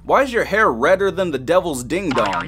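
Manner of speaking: ordinary talk, nothing marked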